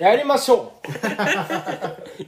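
high, loud, hammed-up standard Japanese accent. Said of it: "今から標準語でいきましょうか".

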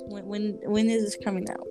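normal talking voice

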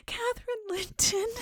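high, crying ghost voice; ghostly child. Acted. Catherine's ghost naming herself to the dreamer, high and crying. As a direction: answer like a lost child's spirit. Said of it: "it replied".

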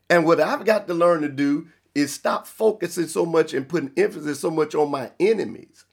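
usual speaking voice

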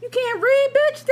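mock him in disbelief; squeaky disbelieving taunt; incredulous jab. Mocking the man's reading.

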